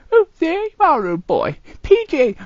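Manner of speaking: ordinary talk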